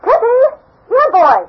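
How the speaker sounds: high, loud call across a park. Acted. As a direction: call the dog from afar. Calling the dog in park.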